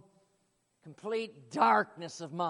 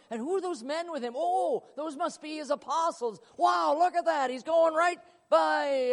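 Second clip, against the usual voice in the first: high, eager, breathless wonder; excited Zacchaeus; 7 semitones higher, 6 dB louder than usual. Acted. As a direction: gush like a thrilled onlooker. Zacchaeus in the tree.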